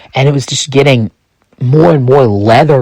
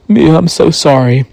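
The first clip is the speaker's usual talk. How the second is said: mildly acted crying apology, a little raised; tearful apology; 3 semitones higher than usual. Narrator apologizing to Mia after dropping the gun, mildly tearful. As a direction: plead for forgiveness with a touch of tears. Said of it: "And I started to cry".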